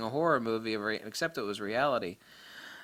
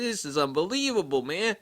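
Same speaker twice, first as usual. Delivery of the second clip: higher, put-on Boston voice; Boston accent; 6 semitones higher, 4 dB louder than usual. Boston caller reacting to the photos, imitated Boston accent. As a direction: mimic an amazed Bostonian. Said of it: "with his Boston accent".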